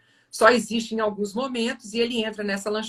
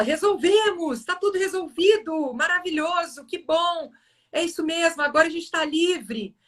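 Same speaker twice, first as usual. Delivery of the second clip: higher-pitched retold victory cheer; triumphant; 7 semitones higher than usual. Boy who has just floored the bully, triumphant. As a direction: act out feeling like the winner. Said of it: "ele se sente vencedor e fala".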